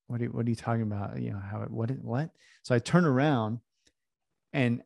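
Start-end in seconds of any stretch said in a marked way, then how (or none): 0.1-2.3 baffled, half to yourself, tripping over the question; softly stammered confusion; confused muttering. Retold or imitated. Baffled reaction to stranger's question.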